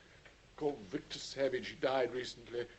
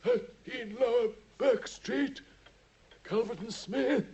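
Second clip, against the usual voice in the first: insistent reminder; 10 semitones higher, 6 dB louder than usual. Acted. Holmes pressing the address on Watson.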